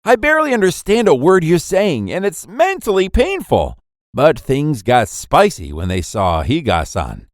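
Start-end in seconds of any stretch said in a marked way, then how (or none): 0.0-3.8 high, pained complaint without shouting; understated mock anguish, pitched up; mild inner scream. A narrator's reading. Boy overwhelmed by her dialect, mild internal scream. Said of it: "was internally screaming".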